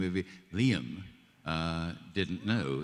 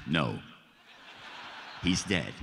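blunt refusal; slower than usual. Retold or imitated. George turns down the pleading actor, blunt and final. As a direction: shut it down with finality.

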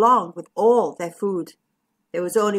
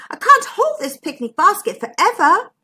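raised, insistent urging; 7 semitones higher, 5 dB louder than usual. A narrator's reading. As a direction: press them to hurry, loud and high. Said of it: "urged McMeer".

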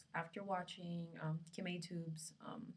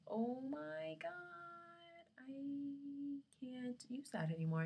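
high, slow, drawn-out mock dismay; exaggerated high-pitched realization; dismayed reaction; 9 semitones higher, much slower than usual. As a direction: stretch each word up high in disbelief.

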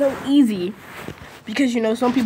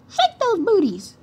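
silly high voice; 12 semitones higher, slower than usual. Acted. Silly sung line.

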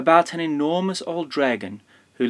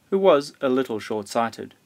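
ordinary delivery

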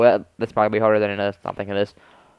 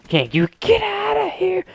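high squeaky taunt. Acted.

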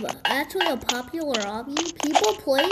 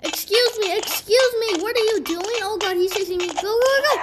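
high-pitched, urgent protest; excited high-voiced alarm; panicked; 6 semitones higher, 3 dB louder than usual. Real, not acted. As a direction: fluster while being chased, voice pitched up. Being chased in game.

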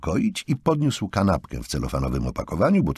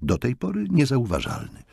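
plain reading voice

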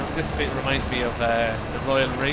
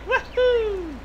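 imitating woohoo; 4 dB louder than usual. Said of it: "I get out of the car to do that".

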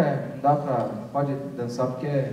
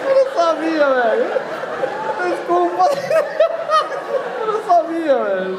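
high, laughing apology; 14 semitones higher, 5 dB louder, slower than usual. Real, not acted. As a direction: say sorry through laughter. (desculpa rindo, voz aguda)

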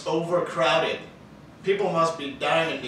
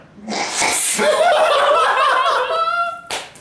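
corny dad laugh; 9 dB louder than usual. Real, not acted. Cracks up at own joke.

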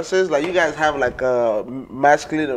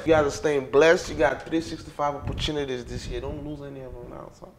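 ordinary delivery

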